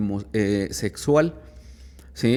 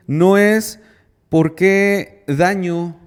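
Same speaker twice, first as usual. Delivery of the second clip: forceful emphasis; 7 semitones higher, 6 dB louder, much slower than usual. Real, not acted. Emphatic point to the group.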